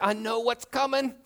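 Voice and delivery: high, rising comic whine; hammed-up anxious squeal; mock dread. Acts out dread of sharing drink.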